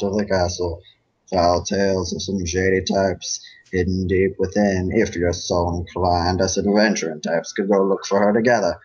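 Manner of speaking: a folksy Southern cowpony sweet-talking a stranger; Braeburn southern accent. Acted. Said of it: "uh, southern accent".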